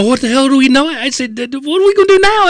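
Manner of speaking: high, frantic retold question; imitating friends in a flustered squeal; mock panic. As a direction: panic like you're trapped in hiding. Friends panic hiding in the closet.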